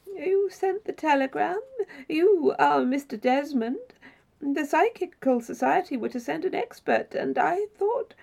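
put-on high, halting old gentleman; puzzled elderly host. A narrator's reading. Narrator voices elderly host.

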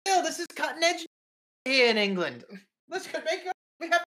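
high, eager newsman voice; excited editor. Acted. Editor excited about a cutting edge story. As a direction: pitch the scoop with eager zeal.

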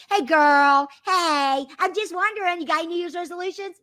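put-on loud, high, bubbly "hey girl" greeting; perky fake friend. Mocking a stranger's gushing fake-friendly sales greeting. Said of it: "somebody that says".

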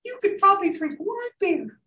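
little tempter voice urging one beer; tempting inner voice. Retold or imitated. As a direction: whisper the temptation like a devil on the shoulder. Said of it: "I hear this little voice in my head".

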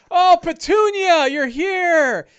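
loud high-pitched squeal of joy; hammed-up ecstatic reunion; overjoyed greeting. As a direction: cry out to a long-lost darling. Big Al greets his lost cow.

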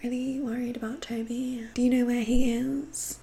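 whispered, sugary fake concern; fake nice whisper. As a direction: whisper it like you secretly want something. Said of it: "really fake nice for a second".

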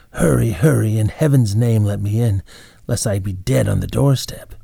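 usual speaking voice

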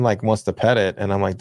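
ordinary talk

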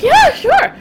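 high, chirpy eager agreement; naive twelve-year-old self. Retold or imitated. Accepting a stranger's invitation as a child, naively chirpy. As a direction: mimic a trusting child saying yes. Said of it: "me and my stupid, like, twelve-year-old self".

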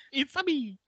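high, soft echo of the joke; mock Mario again. Podcaster repeating the Mario joke, high and soft. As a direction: repeat the gag under a laugh.

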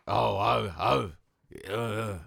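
loud, garbled mock impression; Al Pacino. Actor reacting to who's in the script, mock groaning impression. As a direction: groan it out as a hammed-up movie star. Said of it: "He said".

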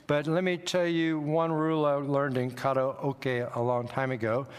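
ordinary talk, nothing marked

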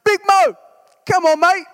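loud, high call across the pond. Retold or imitated. Mates calling Mo across the frozen pond, loud high shout. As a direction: shout to your mate to come over. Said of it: "my mates on the other side going".

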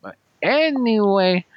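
put-on playful Mexican Spanish accent, Chicano slang. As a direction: lean into a Mexican accent like a homie greeting the crew. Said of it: "interesting accent".

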